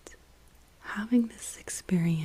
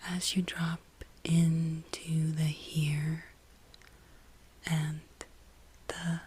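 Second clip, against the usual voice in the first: guided meditation. Real, not acted. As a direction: guide them inward, lingering on each phrase.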